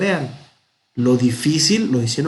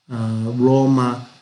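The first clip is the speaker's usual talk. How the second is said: normal talking voice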